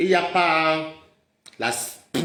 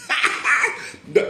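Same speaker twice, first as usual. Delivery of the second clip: laugh; 8 semitones higher than usual. Real, not acted. Laughs at own jibe.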